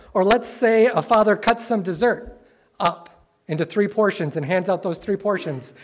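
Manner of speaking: ordinary talk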